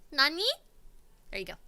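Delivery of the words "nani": higher, cutesy anime Nani; a cute, bouncy anime surprise. Shown as a demonstration.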